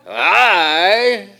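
a big, emphatic "I"; bigger voice. Shown as a demonstration. Preacher showing the class how to make it emphatic, big voice on I. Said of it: "bigger voice".

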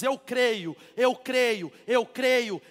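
rising, loud repeated I believe; building declaration. Acted. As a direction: build each creio stronger. Abraham's repeated affirmation. (declaração crescente)

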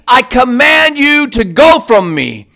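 normal talking voice

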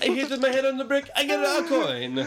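high cartoon Mario voice; chirpy video game plumber. Mario game sound impression.

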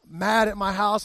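normal talking voice

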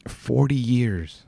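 "forty years" drawn out slowly, each word weighted; slow emphatic. Real, not acted. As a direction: stretch out "forty years" to let it sink in. Repeating the album's age, amazed.

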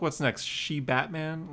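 ordinary talk

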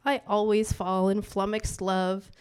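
soft, measured poetry recital; hushed spoken-word opening; poem reading. Acted. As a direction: read it like the first lines of a poem.

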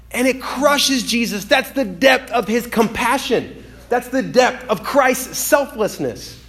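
high, slow, weighty preaching; impassioned preaching. Real, not acted. Preacher to congregation on Christ's compassion, impassioned preaching. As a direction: preach it slowly with a lifted voice.

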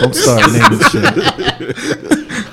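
high, slow words squeezed through laughter; cracking up while telling them to stop; laughing protest. Real, not acted. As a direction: laugh your way through the complaint. Laughing while scolding friend.

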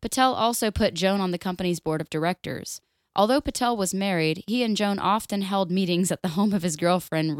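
normal talking voice

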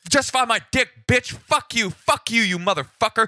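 high-pitched furious tirade; really pissed off. Retold or imitated. Interpreter voicing agitated caller to police, furious high rant. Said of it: "He's, like, really pissed off".

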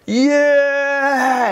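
high, bright stadium yeah; crowd cheer. Retold or imitated. Coach mimics the crowd joining the wave with a high cheer. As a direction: whoop like the crowd catching on.

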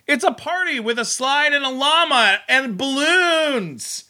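high, slow, sing-song voiceover voice; hammed-up kiddie voiceover; mock voiceover. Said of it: "this is the voiceover you hear".

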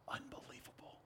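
whispered in wonder; awed whisper. Real, not acted. Preacher marvelling at the forerunner news, whispered. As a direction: breathe it out in awe. Awe at John's calling.

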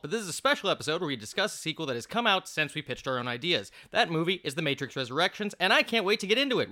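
rapid-fire excited host intro; talking fast. Real, not acted. Host welcoming listeners to a special follow-up episode, talking fast. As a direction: rattle off the welcome at speed. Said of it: "which is why I'm talking so fast".

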